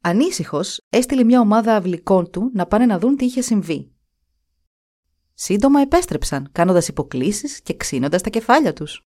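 ordinary delivery

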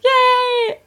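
very high, squeaky yay; excited cheer. Pretend. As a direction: cheer like a cartoon. Mock cheer.

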